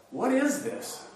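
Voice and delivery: high-pitched astonished question; amazed. Acted. Amazed people question among themselves, astonished. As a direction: ask in wonder, pitched well up. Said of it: "they were all amazed".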